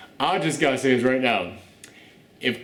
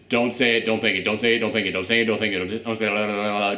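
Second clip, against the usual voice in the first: low, running recital over and over; repetitive droning; 8 semitones lower, faster than usual. Retold or imitated. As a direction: reel it off in a low, unbroken loop. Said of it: "just over and over and over again".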